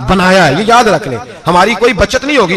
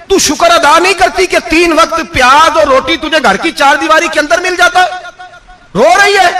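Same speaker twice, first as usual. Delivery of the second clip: angry rebuke; 11 semitones higher than usual. Retold or imitated. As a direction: tear into her for ingratitude. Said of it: "हजरत उमर जलाल में आ गए".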